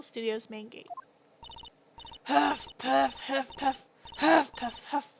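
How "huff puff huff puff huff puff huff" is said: puffing hard out of breath; winded old woman panting; huffing and puffing. Acted. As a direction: pant out every huff and puff. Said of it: "all that huffing and puffing".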